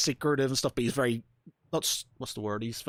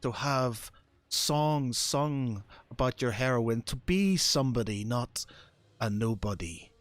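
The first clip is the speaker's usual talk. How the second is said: a hint of a seductive patron; 3 dB quieter than usual. The voice tempts Arno again.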